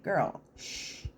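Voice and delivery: whispered shush, soft and breathless; hushing whisper. Retold or imitated. Telling Rosie to hush, in a whisper.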